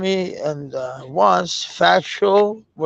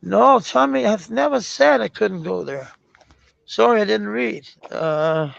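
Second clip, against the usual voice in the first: usual speaking voice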